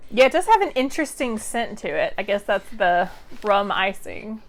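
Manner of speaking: usual speaking voice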